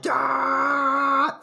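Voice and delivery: high, loud sustained "aah"; drawn-out, hammed-up battle yell; mock movie scream. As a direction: imitate an overlong death scream. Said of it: "Why are you screaming like this".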